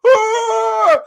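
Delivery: high, long "ah"; comic wail from the floor; imitated scream. Donna with cheese balls on her neck, imitated scream.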